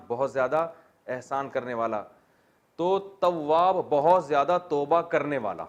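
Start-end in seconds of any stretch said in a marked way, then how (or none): 2.8-3.8 تواب slow, higher and louder; stress on تواب. Shown as a demonstration. Teacher stresses تواب so students remember it. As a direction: lean hard on the word تواب. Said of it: "stressing the key word".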